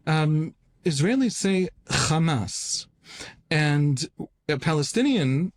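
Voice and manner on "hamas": Hamas with a rough throaty kh at the start; Hamas, kh sound from the throat; guttural Cha. Shown as a demonstration. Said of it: "They mock the Cha of Hamas".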